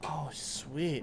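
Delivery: soft breathy whisper. Real, not acted. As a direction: whisper it under your breath. Whispered reaction to the note.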